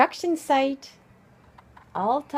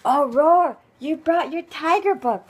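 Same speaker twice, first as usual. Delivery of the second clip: louder delighted exclamation; 2 semitones higher, 7 dB louder than usual. Real, not acted. Child brought tiger book.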